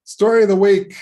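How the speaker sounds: high, loud announcer call of the title; segment announcement. Real, not acted. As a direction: punch the segment name out like a jingle.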